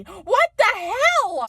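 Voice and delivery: high, slow, drawn-out disbelief; dragged-out high what the hell; exasperated. Real, not acted. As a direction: stretch it out in outrage. Peak of the small-town rant.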